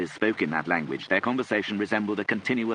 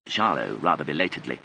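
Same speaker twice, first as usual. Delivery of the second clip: ordinary delivery, nothing marked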